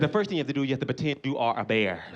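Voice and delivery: low-pitched instructing voice; imitated documentary host. Bear man instructs the viewers, low host voice. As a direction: explain it low, as a guide to viewers. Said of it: "He said".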